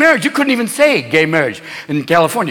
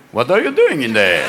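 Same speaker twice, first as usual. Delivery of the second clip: mock nosy question; 10 semitones lower than usual. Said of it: "And I'm going".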